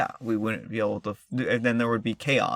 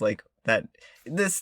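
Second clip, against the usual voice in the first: usual speaking voice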